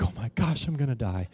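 soft mock-despairing aside; inner dread. Hiker secretly thinking he'll die, quietly despairing. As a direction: drop to a private comic groan. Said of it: "I'm, like, thinking inside".

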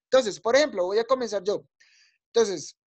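normal talking voice

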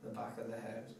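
hushed, unhurried guide into deep relaxation; guided meditation. Acted. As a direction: talk them softly through a body scan, letting each phrase settle. Leads relaxation body scan.

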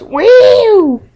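very high, loud whooping woo; imitating a YouTuber's signature loud woo; Tobuscus woo. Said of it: "He always goes".

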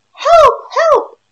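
high, loud squawking plea; duck crying for help. Acted. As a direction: shriek for help like a trapped duck. Duck calls again.

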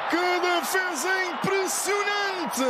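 slow, high shout of amazement; excited save call. Real, not acted. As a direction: stretch out the wonder at the save. Dramatic save in live commentary. (grito de espanto, lento e agudo)

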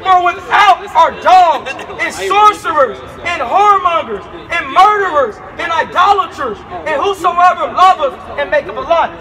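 slow, loud street preaching of the verse. Acted. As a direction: hammer each sin out. Preacher reads Revelation aloud.

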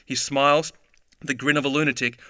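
usual speaking voice

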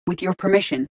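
ordinary talk, nothing marked

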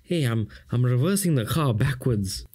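lightly voiced Durban Indian accent; a hint of Durban Indian speech. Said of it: "we like to speak like this".

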